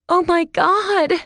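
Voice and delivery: high, loud, slow scream. A narrator's reading. Girl in the crowd screaming in shock, high loud scream. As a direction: shriek it in shock. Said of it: "a girl screamed".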